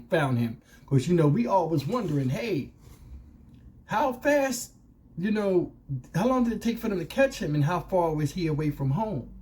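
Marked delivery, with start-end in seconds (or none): none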